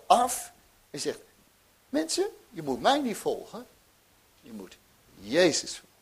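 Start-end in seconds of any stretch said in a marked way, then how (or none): 1.9-5.9 a hint of John calling the people; preacher voicing John. Johannes addresses the crowd. (Johannes die de mensen toeroept, licht gespeeld)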